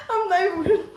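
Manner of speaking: high loud laugh into words; helpless high laughter. Real, not acted. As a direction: crack up mid-sentence. Laughing at herself.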